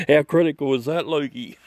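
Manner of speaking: ordinary delivery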